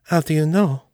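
lifted servant voice; French maid voice. A narrator's reading. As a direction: ask it as the devoted French maid. Maid questions John in story.

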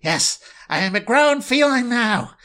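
lofty put-on cat voice; proud cat voice. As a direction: declare it grandly as the cat. Cat character answers in put-on voice.

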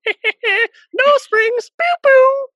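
loud, very high squeaky taunt; Coily. Retold or imitated. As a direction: squeal it like a gleeful cartoon sprite. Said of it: "you hear Coily's voice going".

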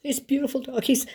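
high, halting imitation of a weepy caller; acted-out sobby, high phone voice; tearful friend. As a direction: gush through mock tears, haltingly. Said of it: "she is weeping. She is crying".

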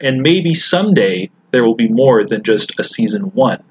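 post-apocalyptic radio host outro; radio announcer. Acted. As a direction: sign off like a lonely wasteland DJ. Podcast outro in radio persona.

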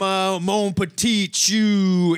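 slow mock Cajun Southern accent; stretched-out, hammed-up Louisiana accent; Gambit. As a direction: drawl the French in exaggerated Southern. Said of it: "The Southern accent they write for him".

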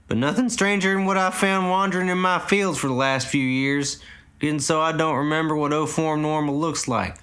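put-on old farmer. A narrator's reading. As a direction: keep the old man's country voice. Old farmer keeps talking.

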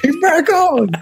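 high cheering echo; excited shout. Retold or imitated. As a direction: shout it gleefully. Echoes cheering line.

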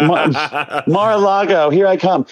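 high-pitched gleeful announcement; mock excitement. Playing along with the Trump joke, high mock excitement. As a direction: pipe it up high like an eager new hire. Joking mock eagerness about the job.

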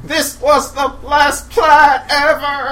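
sarcastic shout. Acted. Sarcastic panic while escaping.

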